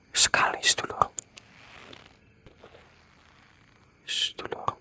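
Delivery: trailing into an unvoiced whisper. Real, not acted. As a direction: let the voice fall away into breath. Describing the sad crying.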